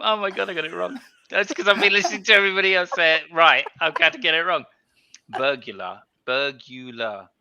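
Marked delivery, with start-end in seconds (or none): none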